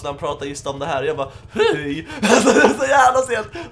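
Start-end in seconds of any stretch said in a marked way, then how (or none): 1.5-2.1 a sudden cracking squeak, much higher and louder. Retold or imitated. Retelling his live-TV voice crack, squeaking the hello. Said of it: "Min värsta voice crack". 2.1-3.7 laughing through the words, higher and louder; real laughter at his own blunder. Real, not acted. Gamer laughing while retelling his TV voice crack.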